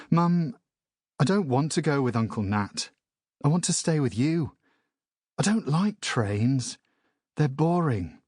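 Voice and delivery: a hint of a sulky young son begging his mum; whiny boy. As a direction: plead like a sulky kid who hates trains. Child pleading with his mother.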